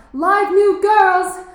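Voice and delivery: loud, slow, drawn-out street barker call; mock barker shout. Boy mocking her dad as a street hawker, mock barker shout. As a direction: bellow it like a strip-club tout. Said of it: "those guys that shout".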